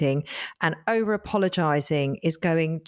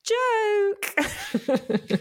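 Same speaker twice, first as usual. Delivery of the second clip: laughing. Real, not acted. Joking after fake apology.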